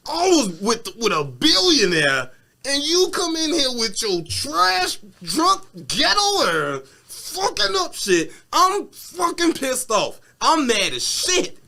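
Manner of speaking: high, slow furious rant; acted outrage. Tory, pissed at the drunk girl in his car, high slow outraged rant. Voicing Tory's angry rant.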